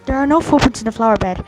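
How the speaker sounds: ordinary talk, nothing marked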